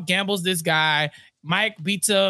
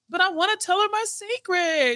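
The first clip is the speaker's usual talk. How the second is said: higher put-on childish whine; a lightly voiced sulky boss, pitched up; whiny mock pout; 11 semitones higher than usual. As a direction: pout like a kid who wants his way. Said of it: "And Harvey's like".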